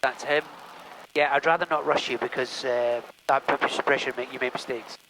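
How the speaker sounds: ordinary talk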